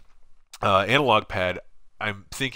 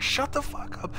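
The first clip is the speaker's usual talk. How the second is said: mock whispered snap; 5 dB quieter than usual.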